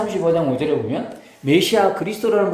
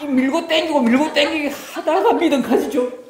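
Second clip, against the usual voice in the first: ordinary talk, nothing marked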